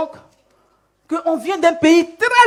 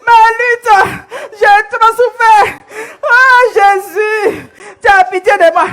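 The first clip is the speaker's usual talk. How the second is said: acted wailing; 7 semitones higher, slower than usual. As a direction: wail it out like a suffering woman begging Jesus. Woman in story wails to Jesus.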